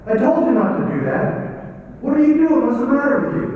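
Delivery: loud, high scolding husband; rising anger at his wife; angry husband. Retold or imitated. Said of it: "the husband gets mad at her".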